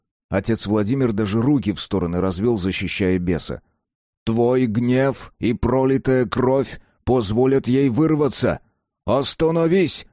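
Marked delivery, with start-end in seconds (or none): 4.2-10.0 slow, emphatic warning rising to a high order; priest's urgent command. Acted. Priest shields the demon and warns the man, urgent command. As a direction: admonish slowly, then lift the last word.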